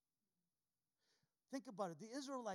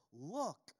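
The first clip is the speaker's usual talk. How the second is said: a firm louder Look to open the quote; stress on look; 5 dB louder than usual. Shown as a demonstration. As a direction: Look hit loud and firm. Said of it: "underline that word".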